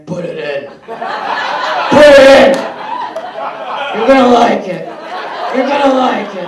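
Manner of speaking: loud, slow mock boyfriend voice; over-the-top boyfriend in the dark; boyfriend's bedroom voice. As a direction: ham up the boyfriend's bedroom urging. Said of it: "suddenly you hear".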